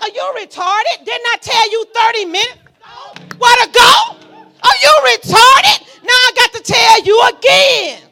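high, loud, furious scolding of the devil; exasperated shout. Retold or imitated. Preacher yelling at the devil who came back, furious shout. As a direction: yell at him like a hard-headed child. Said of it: "I'm like".

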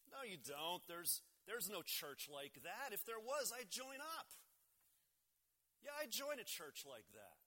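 insistent retort, climbing high at the end; protest, rising into eager conviction; emphatic disbelief. A narrator's reading. Harry answering Tony about his church, emphatically disbelieving.

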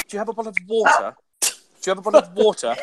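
quick posh voice with crisp t in water; posh British accent. Retold or imitated. [name] showing his posh voice to American girls. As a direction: bottle of water said with careful t. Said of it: "I was emphasising the T".

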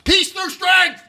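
high-pitched mock yell; all-caps yell. Host acting out Trump's all-caps tweet, high mock yell. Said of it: "then he yells in all caps".

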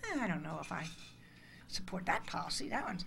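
mock the waffling answer, backing off softly; hesitant mumble. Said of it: "And you're gonna say".

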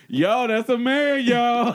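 loud, high shout over the intercom; hammed-up public callout; mock intercom announcement. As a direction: announce it loudly like you own the intercom. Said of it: "they on the motherfucking intercom".

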